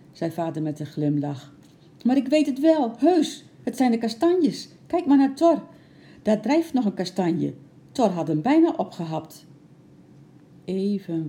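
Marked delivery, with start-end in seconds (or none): 2.0-9.5 put-on high, loud child's protest; breathless-to-convince excited voice, easing at the end; excited insistence. A narrator's reading. Excited girl insists on her discovery.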